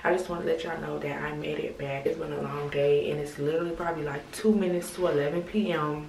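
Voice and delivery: normal talking voice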